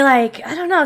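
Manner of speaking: ordinary talk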